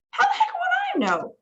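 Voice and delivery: very high, exasperated cry. Acted. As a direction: throw up your hands. Exasperated ground control.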